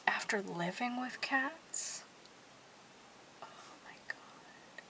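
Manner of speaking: awed whisper, fading away; hushed whisper of amazement. Real, not acted. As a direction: breathe it out in awe. Awe at living with cats.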